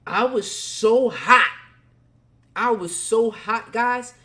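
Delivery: loud, drawn-out outburst; heated emphasis. Real, not acted. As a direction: lean hard on how hot you were. Recalling his fury, emphatic.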